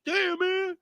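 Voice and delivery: high, whiny sulk; whiny friend voice. Retold or imitated. Friend Jazz sulking about the bill in a high whine. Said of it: "He like".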